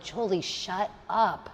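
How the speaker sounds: slow, quiet order to yourself; self-command. Retold or imitated. Telling herself off for complaining, quiet commanding. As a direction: shut your own complaint down, softly and slowly. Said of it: "That's, like, commanding".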